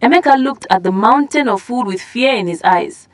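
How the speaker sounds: ordinary delivery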